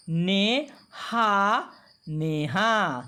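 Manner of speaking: "ne", "ha", then joined "neha", slowly; stretched syllables blended into "neha"; sounding out "neha". Shown as a demonstration. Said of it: "बारी बारी से पढ़ने का अभ्यास करेंगे".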